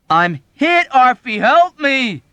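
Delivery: very high, loud, slow plea; desperate cry. Acted. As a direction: scream for help. Yossarian begging for help.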